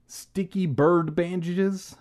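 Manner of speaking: commercial pitchman. A narrator's reading. Actor reciting his bandage ad, pitchman voice. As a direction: pitch the brand with showy slowness.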